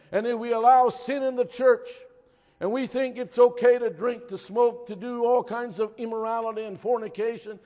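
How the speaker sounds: loud, high-pitched urgent sermon; forceful preacher, hammering key words; impassioned preaching. Real, not acted. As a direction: drive the warning home to the congregation. Preaches warning at full force.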